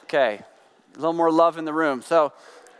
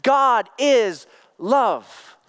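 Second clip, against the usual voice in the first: very slow, each word weighted; a slow, reverent proclamation; slow solemn declaration; 3 semitones higher, 3 dB louder, much slower than usual. Real, not acted. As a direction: pronounce it slowly like a creed. Preacher's climactic quote.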